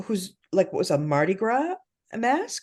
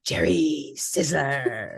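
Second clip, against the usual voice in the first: slow, strained laughing-crying voice; Jerry Sizzler voice; 4 semitones lower, much slower than usual. Retold or imitated. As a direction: groan it out, half laughing. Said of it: "I'm like".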